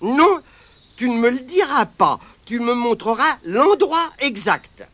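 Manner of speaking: insist, no tricks; boy's order. Acted.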